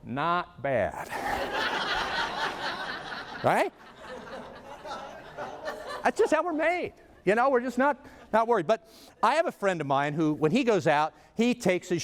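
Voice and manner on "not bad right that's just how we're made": smug self-approval. Retold or imitated. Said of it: "in front of a full-length mirror and go".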